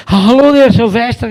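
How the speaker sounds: loud, high-pitched Scottish greeting down the phone; upset Scottish reporter. Retold or imitated. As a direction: imitate an indignant Scottish newspaperman. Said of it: "the guy said".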